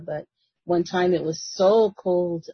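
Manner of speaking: ordinary talk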